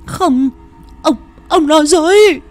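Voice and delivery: high, loud, anguished scream; screaming denial. Acted. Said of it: "Cô Đào hét lên một hồi".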